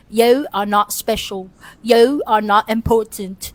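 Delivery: measured mock-British reading, drawing out the vowels; British accent. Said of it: "Let me read it in a British".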